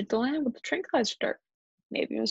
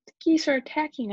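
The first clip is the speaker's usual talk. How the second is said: high, raised storybook alarm; alarmed shout; 6 semitones higher, 4 dB louder than usual. A narrator's reading. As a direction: sound the alarm to everyone. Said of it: "I shouted".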